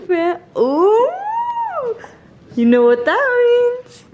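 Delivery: high squealing excitement; excited. Real, not acted. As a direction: squeal it out, thrilled. Thrilled at fancy hotel room.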